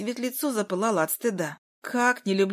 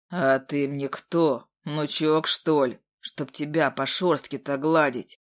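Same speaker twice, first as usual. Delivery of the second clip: put-on 1 grumpy old man; 6 semitones lower, slower than usual. A narrator's reading. Old man answers demon.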